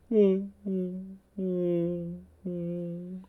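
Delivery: high, held, ghostly "oh"; imitated recurring eerie noise. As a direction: mimic a spooky tone fading away. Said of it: "it just keeps getting like".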